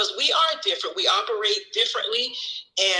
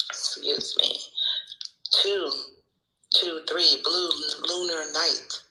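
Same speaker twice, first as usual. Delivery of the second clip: ordinary talk, nothing marked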